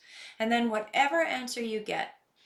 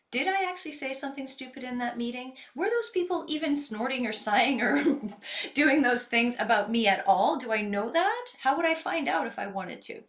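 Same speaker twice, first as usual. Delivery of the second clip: questions rising higher and louder, building; lively self-questioning; 5 semitones higher, 5 dB louder than usual. Shown as a demonstration. Coach voicing the doubts after the meeting. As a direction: puzzle it out with growing energy.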